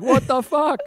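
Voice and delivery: high-pitched New York exclamation; New York accent. Retold or imitated. Bonding with two mooks, indignant New York accent. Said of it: "talking in a New York accent back".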